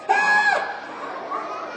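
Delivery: very high shriek; hammed-up high yelp; comic pain scream. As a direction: scream as if hurt. Hit again, screams.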